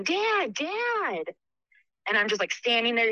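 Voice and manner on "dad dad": high, urgent calls for Dad; calling out for Dad in an empty house; retold yelling. Scared girl calling for her dad. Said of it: "And I start yelling".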